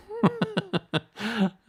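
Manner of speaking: a quick high-pitched giggle at himself. Real, not acted. Streamer laughing at his own hair joke, high giggle.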